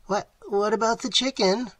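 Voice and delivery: high-pitched, stammering wail; groaned plea. A narrator's reading. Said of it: "groaned Falabai".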